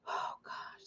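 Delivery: breathless whisper of fatigue; tired whisper. Real, not acted. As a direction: exhale the words. Exhausted aside.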